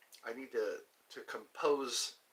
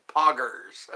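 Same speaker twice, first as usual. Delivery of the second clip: slow, louder "I said poggers"; poggers on request; 8 dB louder than usual. Shown as a demonstration. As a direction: drag out "I said poggers" with a push on I. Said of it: "someone asked me to say poggers".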